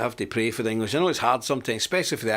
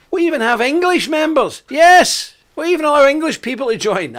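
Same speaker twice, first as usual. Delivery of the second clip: mock-shocked exclamation; 13 semitones higher, 9 dB louder than usual. Real, not acted. Mock shock at allowing English.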